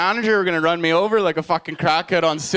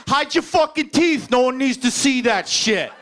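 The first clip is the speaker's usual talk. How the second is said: tough stranger; 4 semitones higher than usual. Retold or imitated. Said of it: "he literally goes".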